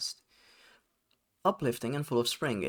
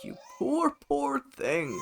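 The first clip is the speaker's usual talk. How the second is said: much higher, syrupy pity; high cooing spirit; slower than usual. Acted. Star spirit pitying the player, high and syrupy. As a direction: coo it way up high.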